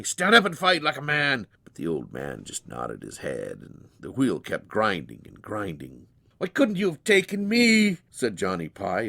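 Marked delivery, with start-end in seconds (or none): 0.0-1.5 louder, defiant demand; angry challenge. Acted. Grieving father challenges old man. 6.4-8.0 high, loud grief-stricken plea; anguished cry. Acted. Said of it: "as if those words had never been said".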